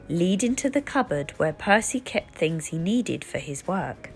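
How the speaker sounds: usual speaking voice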